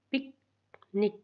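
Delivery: Picnic as short पिक, long pause, short निक; two short beats in picnic, far apart; split into syllables. Shown as a demonstration. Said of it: "छोटी ई की sound".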